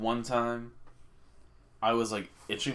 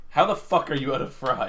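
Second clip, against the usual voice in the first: louder, indignant retold rant; mock outrage; 4 semitones higher, 7 dB louder than usual. Retelling the fries shortage to his friend, mock outraged. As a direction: fake fury at the empty kitchen.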